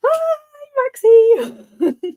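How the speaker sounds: high, loud squeal of Hey; excited greeting. Real, not acted. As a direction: greet a friend with delight. Greeting Maxie.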